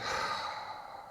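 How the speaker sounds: long breathy release sigh; big audible sigh. Shown as a demonstration. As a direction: let it all out on a sigh. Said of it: "Just a big sigh".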